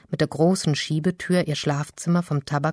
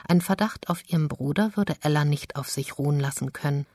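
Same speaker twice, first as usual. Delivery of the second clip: ordinary talk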